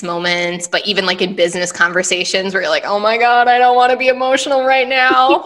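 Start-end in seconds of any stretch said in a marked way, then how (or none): 2.9-5.5 mock panicked plea. In a business talk, a higher, panicky inner plea. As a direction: plead with yourself not to cry.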